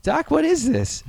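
put-on high-pitched, slow, puzzled movie-kid voice; Michael J. Fox as Marty McFly. Marty asking Doc about what they see, high impression. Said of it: "You do a great Michael J. Fox".

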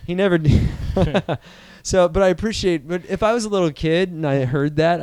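ordinary talk

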